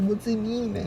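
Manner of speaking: lightly voiced teasing pest; mock stalker whine. Stalker keeps asking again, high teasing mock.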